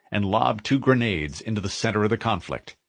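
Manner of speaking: plain reading voice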